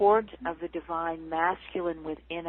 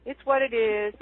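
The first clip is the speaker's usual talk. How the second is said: breezy platitude; 4 semitones higher, 6 dB louder than usual. Retold or imitated. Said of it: "You know".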